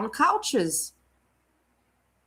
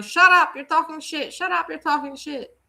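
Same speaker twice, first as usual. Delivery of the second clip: voicing the cats, higher, sharp burst at the start then thinner; cats talking back; 6 semitones higher than usual. Streamer voicing Perry Dodd's cats mocking him, higher and snippy. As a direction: give the cats a snippy voice telling their owner to shut up. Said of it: "your cats are meowing".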